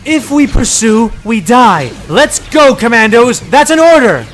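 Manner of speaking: loud, high sharp command; snapped order. Acted. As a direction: cut him off and rally the men. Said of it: "she snapped".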